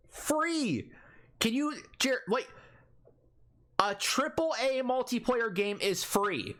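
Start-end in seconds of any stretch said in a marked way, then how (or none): 0.1-0.9 one word flung up high in disbelief; exasperated disbelief. Real, not acted. Ranting gamer to his cohost, stunned and pitched high. Exasperated about free multiplayer.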